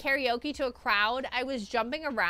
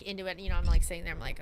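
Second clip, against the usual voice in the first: usual speaking voice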